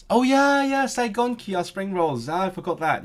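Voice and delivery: delighted recognition. Real, not acted. Streamer suddenly remembers a forgotten word, excited recognition. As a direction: burst out as it comes back to you.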